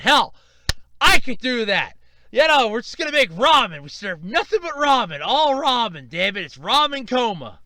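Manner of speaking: loud, high, emphatic riffing; fired-up boast. Real, not acted. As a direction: declare it loudly, all fired up. Riffs on opening ramen restaurant.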